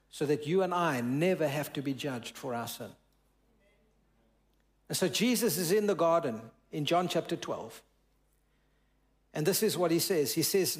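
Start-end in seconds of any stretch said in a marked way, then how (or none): none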